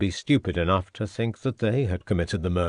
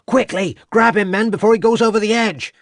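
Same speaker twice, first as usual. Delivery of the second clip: high, loud and fast orders; urgent yell; 12 semitones higher, 6 dB louder than usual. A narrator's reading. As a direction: shout the men forward. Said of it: "yelled Crabbe".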